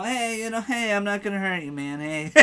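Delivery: high, soft gentle grandpa voice; a kindly old grandpa calming someone; old man grandpa voice. Retold or imitated. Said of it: "this old man grandpa voice".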